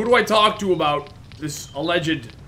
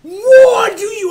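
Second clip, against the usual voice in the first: high, loud, slow frustrated yell; angry shout; 11 dB louder, slower than usual. Real, not acted. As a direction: yell at the game in rage. Raging at the game.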